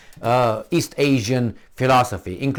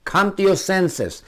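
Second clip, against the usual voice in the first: raised, slow rebuke; forceful scolding; 7 semitones higher, slower than usual. Shown as a demonstration. Showing how to bring them round, stern scolding. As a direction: stretch it out as a stern order. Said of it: "That's how you should do".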